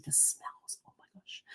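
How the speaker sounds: breathy hushed whisper of delight; awed whisper. Real, not acted. Remembering the Budapest bakery smells, a hushed whisper.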